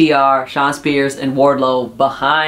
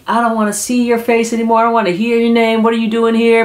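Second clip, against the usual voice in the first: higher, mock-furious rebuke; imitating angry Jericho; 8 semitones higher, faster than usual. Jericho telling Sammy to leave, in a mock angry rebuke. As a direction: throw him out in a hammed-up rage.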